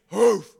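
sudden loud woof like a dog; loud dog bark. Retold or imitated. Superintendent answering the joke with a loud bark.

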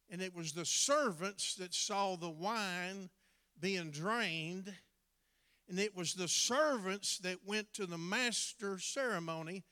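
slow, loud preaching voice; preaching at full force. Acted. Preacher hammering the point to church, slow and loud. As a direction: lean on every word, slow and strong.

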